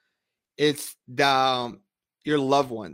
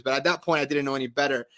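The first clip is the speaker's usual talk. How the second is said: ordinary talk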